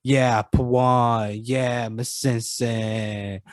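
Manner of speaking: long drawn-out pretend cheers; mock fan cheering. Host imagining fans at a daytime talk show, mock cheering. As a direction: cheer slowly like a pretend studio audience. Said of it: "would you show up and be like".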